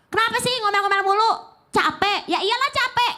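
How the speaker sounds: high, shrill-pitched mock nagging at a tired child; nagging mother. Mother scolding her tired child, high mock nagging. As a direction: snap back like an irritated mom. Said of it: "Kan kalau kita mah".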